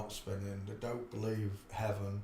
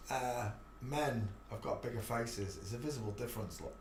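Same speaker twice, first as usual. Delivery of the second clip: ordinary delivery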